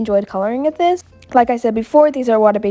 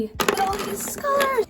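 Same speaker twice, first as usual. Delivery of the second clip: delighted; 12 semitones higher, 7 dB quieter than usual. Real, not acted. Delighted at new markers.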